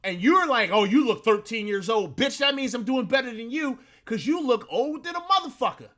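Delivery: ordinary talk, nothing marked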